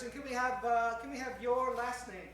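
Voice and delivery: usual speaking voice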